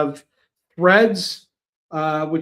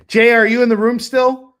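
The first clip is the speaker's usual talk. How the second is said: raised, louder voice calling across to someone; 7 semitones higher, 10 dB louder than usual. Real, not acted. Host checks if Jay is still in the room, calling out loudly. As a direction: call out to check they're there.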